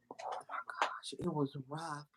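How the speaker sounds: whispered, almost no voice; hushed whisper. Real, not acted. Remembering the rough club, whispering in shock. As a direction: breathe it out in awe. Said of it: "awed hush".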